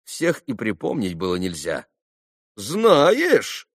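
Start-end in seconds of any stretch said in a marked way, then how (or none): 2.5-3.7 higher, mocking query; ironic question. A narrator's reading. Boy answering an angry stranger, ironically. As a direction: toss it back with irony. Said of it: "иронически спросил он его".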